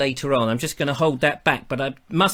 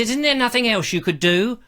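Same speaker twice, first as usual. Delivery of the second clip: higher-pitched imitated listener; lightly put-on impersonation, pitched up; Pearl; 6 semitones higher than usual. Listener asking host for another way, imitated voice. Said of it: "everybody I do an impersonation all speaks like that".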